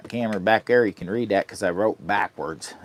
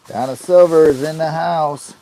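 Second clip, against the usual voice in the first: higher, louder announcer call; loud greeting; 8 semitones higher, 8 dB louder than usual. Real, not acted. Welcoming viewer Donna as a loud MC.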